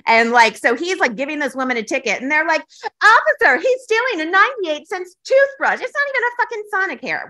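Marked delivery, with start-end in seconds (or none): 3.0-7.3 high, urgent mock report to police; mock outrage. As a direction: tattle to an officer in hammed-up alarm. Acting clerks reporting a theft.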